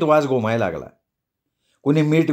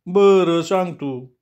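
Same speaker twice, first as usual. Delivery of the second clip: reluctant slow reply; 5 semitones higher, much slower than usual. Acted. Policeman hesitant, slowed.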